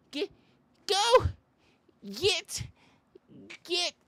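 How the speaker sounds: high squeaky shoo calls; mock shooing. Comic plays a timid person shooing a bear, high.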